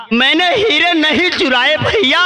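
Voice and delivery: high, pleading wail on हीरे, falling to भैया; pleading cry. Acted. Raja Ram begs his beaters, a high pleading cry. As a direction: beg them to believe, voice lifted high on हीरे. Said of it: "राजा राम बस यही कहता है कि भैया".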